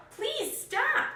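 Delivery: very high pleading cry; old couple calling after the cookie. Acted. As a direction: beg him to stop from far behind. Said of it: "they yelled".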